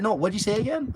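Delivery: high-pitched, feigned not hearing; trolling. Retold or imitated. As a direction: retell baiting him with a fake innocent question. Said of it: "kept trolling him in the plane".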